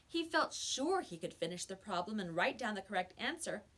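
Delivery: usual speaking voice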